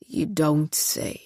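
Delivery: slow, low, script-read drawl; a deliberate man reading lines slowly; deep man's voice. Acted. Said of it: "The voice was a deep-voiced man".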